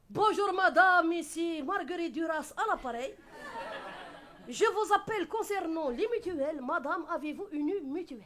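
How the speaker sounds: a hint of a bled accent on a sales call; North African accent. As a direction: play a call-centre agent with a bled accent. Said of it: "Marguerite Duras avec son accent du bled".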